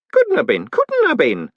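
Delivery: high, insistent protest, climbing on the repeat. A narrator's reading. Groat contradicting the golem, protesting. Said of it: "Groat protested".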